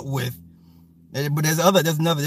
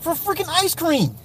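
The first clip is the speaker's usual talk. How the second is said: exasperated; 14 semitones higher than usual. Real, not acted. Exasperated with kid.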